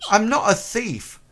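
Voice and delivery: put-on high young boy explaining; boy's voice. Boy protesting innocence.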